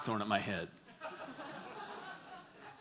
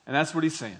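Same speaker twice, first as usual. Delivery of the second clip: ordinary delivery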